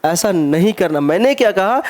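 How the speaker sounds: normal talking voice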